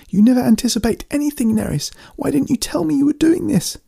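high-pitched, furious accusation; very high, upset demand; accusing shriek. A narrator's reading. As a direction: shriek it in angry distress. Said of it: "Amanda shrieked".

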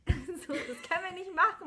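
laughing retold scolding. Cohost retells stopping her partner, fast laughing reprimand. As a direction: laugh through the words. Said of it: "Ich so, Saskia".

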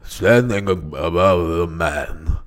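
slow, low statesman impression; Winston Churchill. Shown as a demonstration. Attempting an announced impression for his friend, low orator voice. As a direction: sink down into ponderous oratory. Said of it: "I'm gonna do Winston Churchill".

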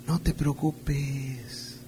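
soft, slow, coaxing tempter voice; the enemy's whisper. Acted. A preacher voicing the enemy, soft and slow to lull the flock. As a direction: lull them with a hushed, drawn-out lie. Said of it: "una de sus frases que es".

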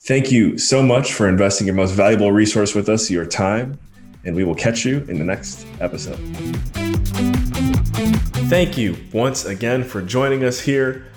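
upbeat host sign-off; podcast outro. Acted. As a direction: wrap up the show like a host.

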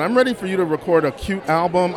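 ordinary delivery

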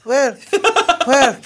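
high, feminine mock accent; feminine impression. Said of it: "a wee bit more feminine".

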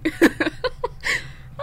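high bashful laugh rising; giddy embarrassed laugh. Real, not acted. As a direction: laugh like you're caught blushing. After mentioning her date.

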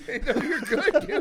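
high, slow words squeezed out through laughter; laughing. Real, not acted. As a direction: laugh it out in disbelief.

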